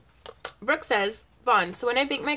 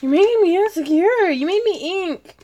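mock offended; 9 semitones higher, 7 dB louder than usual. Mock offended protest to friend.

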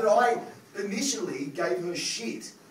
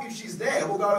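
usual speaking voice

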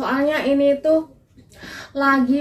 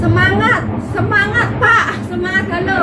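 calling out; 7 semitones higher, 4 dB louder than usual. Real, not acted. Cheering on planes overhead.

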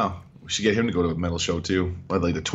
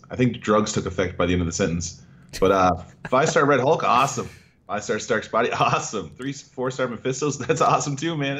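ordinary delivery

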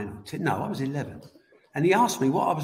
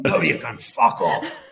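lower, slow, mock-gruff refusal; gruff brush-off; 4 semitones lower, 5 dB louder than usual. Footballer refusing the boy an autograph, slow mock-gruff brush-off. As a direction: slowly wave the kid off like a hard man.

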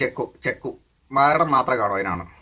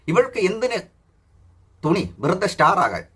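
higher, slow sneering read of star; mocking read; 6 semitones higher, slower than usual. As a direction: read the comment back in a high mocking sing-song. Mock-reading a commenter's post. (കളിയാക്കി ഉയർന്ന സ്വരത്തിൽ വായന)

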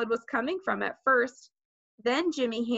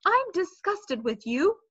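higher, mildly angry scolding; raised voice; 7 semitones higher than usual. A narrator's reading. Mother blames Peter for Fudge's fall, raising her voice. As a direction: scold him, slowing on the last sentence. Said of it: "My mother raised her voice".

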